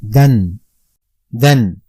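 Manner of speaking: "done" said slowly and clearly as the Arabic nunation "dun"; Arabic sound demo. Shown as a demonstration. Teacher to pupils, slow clear Arabic demo. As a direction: spell out "done" one careful Arabic sound at a time. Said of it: "I say".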